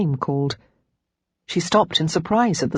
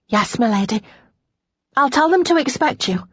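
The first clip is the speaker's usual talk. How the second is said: higher maid's voice; eager servant's reply, pitched up; obedient maid; 7 semitones higher than usual. A narrator's reading. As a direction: answer your mistress dutifully.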